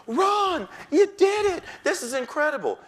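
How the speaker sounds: a principal bursting with delight over scores; thrilled principal. Retold or imitated. Principal praising test scores.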